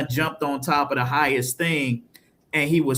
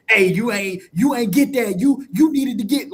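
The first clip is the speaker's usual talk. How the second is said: mock angry dad, raised pitch; scolding father; 6 semitones higher, 4 dB louder than usual. Father whooping his son over a dropped weight, mock angry scolding. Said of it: "daddy had pulled out a belt and started whooping him, like".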